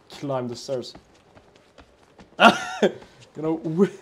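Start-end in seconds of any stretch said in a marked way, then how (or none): 2.4-2.9 squeaky burst of nervous laughter; high nervous laugh. Real, not acted. Gamer laughing high and nervous at the dark stairs. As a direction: laugh high out of sheer nerves. Scared laugh in horror game.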